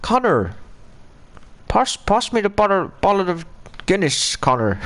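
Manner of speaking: badly faked Irish accent. Shown as a demonstration. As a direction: ask a mate in a jokey Irish accent. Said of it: "I'll do my Irish accent".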